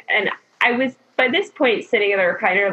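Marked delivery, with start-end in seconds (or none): none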